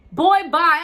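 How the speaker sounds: loud, high, done-with-you brush-off; sassy dismissal. Real, not acted. Woman rejecting her ex's booty call, loud sassy brush-off. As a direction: wave him away with attitude. Dismissing his late-night text.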